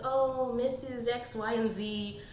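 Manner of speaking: soft, slow, lightly dreamy sigh of names; mock lovestruck daydream; dreamy doodling crush. A lovestruck girl doodling names, soft and slow. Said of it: "it's like".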